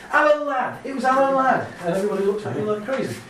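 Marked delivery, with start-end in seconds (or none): 0.1-1.7 eureka, loudly naming Alan Ladd; sudden excited recall. Retold or imitated. Remembering the actor's name while walking down the street, excited. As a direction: blurt it out loud and high.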